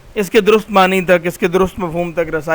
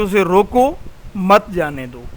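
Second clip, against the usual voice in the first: "उसे रोको", a pause, then "मत जाने दो"; pause after रोको. Shown as a demonstration. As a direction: break the line right after "रोको". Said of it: "कामा डाल दिया जाए यानी ठहराव हो".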